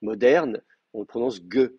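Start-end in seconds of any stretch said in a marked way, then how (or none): 1.5-1.7 GE with a hard stop g. Shown as a demonstration. Teacher showing modern Hebrew pronunciation, hard g in GE. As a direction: say "ge" with a hard plosive g. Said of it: "la prononciation est toujours dure".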